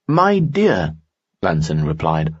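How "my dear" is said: higher, courtly nobleman voice; a charming noble, pitched up; Lanson's voice. Acted. Lanson speaks in story.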